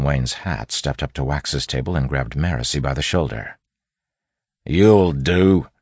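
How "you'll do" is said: higher, louder bandit voice; menacing bandit. A narrator's reading. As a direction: claim a captive with loud menace. Bandit grabs a hostage.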